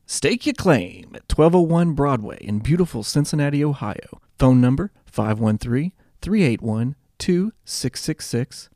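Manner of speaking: relaxed sponsor read. Acted. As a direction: read the sponsor spot casually. Sponsor read before episode.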